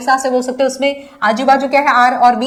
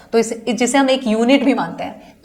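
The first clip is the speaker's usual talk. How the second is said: ordinary talk, nothing marked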